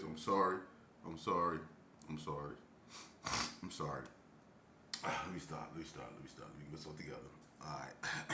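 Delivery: very soft, low apology; getting back serious. Real, not acted. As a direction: settle yourself down under your breath. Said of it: "let me get back serious".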